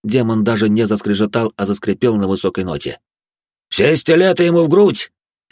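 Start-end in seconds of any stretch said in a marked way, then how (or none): 3.7-5.1 clipped, loud battle order; curt command. Acted. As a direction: bark the order in short bursts. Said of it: "отрывисто произнёс отец Марк".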